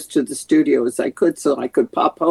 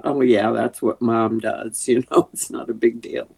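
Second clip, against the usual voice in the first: lightly mocked indifference, pitched down; blasé; 5 semitones lower than usual. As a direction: imitate bored kids shrugging off mom's success. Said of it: "kids are very blasé about".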